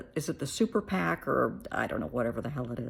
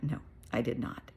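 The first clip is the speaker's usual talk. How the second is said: whispered answer; 5 semitones lower, 6 dB quieter than usual. Retold or imitated. Her reply to the friends.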